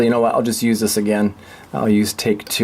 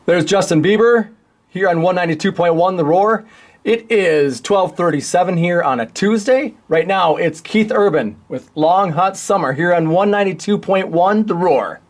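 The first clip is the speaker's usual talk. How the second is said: higher, upbeat on-air radio announcer voice; radio DJ read; 6 semitones higher than usual. Shown as a demonstration. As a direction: sell it like a DJ backselling a song. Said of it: "that was a terrible voiceover".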